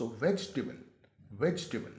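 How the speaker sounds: वेजिटेबल as VEJ-tuh-bul, no middle i; correct pronunciation. Shown as a demonstration. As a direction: model "vej-table" for learners. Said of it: "असल में ये लफ्ज़ है". (वेजिटेबल को "वेजटेबल" बोलें)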